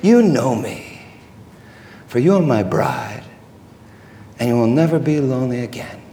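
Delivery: low, soft, slowing reassurance; tender comfort. Acted. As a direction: drop to a quiet, intimate low voice. Jesus tenderly comforts Jenny.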